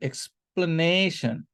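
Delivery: "Explanation" slowly as ex-pla-NAY-shun; teacher modelling "explanation" broken up, with weight on නේ; stress on NAY. Shown as a demonstration. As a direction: stretch නේ in "explanation".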